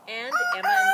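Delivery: high, loud excited squeal of the title. Real, not acted. Teacher to class, squealing the book title with delight.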